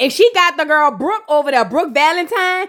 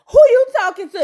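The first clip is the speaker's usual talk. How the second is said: high, loud mock confrontation; indignant challenge; 9 semitones higher, 4 dB louder than usual. Joy challenging Trick, high, loud and indignant. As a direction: throw the question right back at him.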